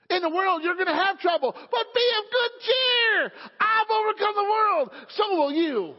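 high, loud shouted proclamation; full-force preaching. Real, not acted. Preacher proclaims victory over trouble to congregation, shouting at full force. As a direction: shout the promise out to the whole church.

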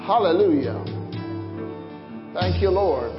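usual speaking voice

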